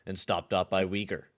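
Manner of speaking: steady, slightly low play-by-play call; low-key announcer calling the action; hockey play-by-play. Acted. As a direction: narrate the replay like a broadcaster. Calls the game video.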